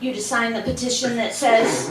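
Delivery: ordinary talk, nothing marked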